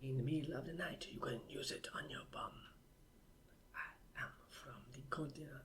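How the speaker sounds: breathy whispered jingle; whispering. Pretend. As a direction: whisper it like a secret.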